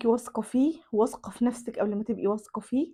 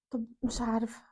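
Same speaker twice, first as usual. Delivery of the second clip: hushed unvoiced whisper to herself; 10 dB quieter than usual. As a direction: whisper it, suspicious. الزوجة تشك وتهمس لنفسها. (همس مرتاب)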